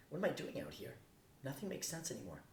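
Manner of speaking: ordinary delivery, nothing marked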